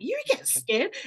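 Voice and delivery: high, rising astonished question; child's wide-eyed disbelief; amazed child. Retold or imitated. Kids asking mum if she gets scared, high amazed. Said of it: "they're like".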